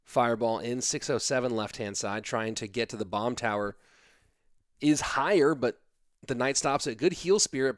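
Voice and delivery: ordinary talk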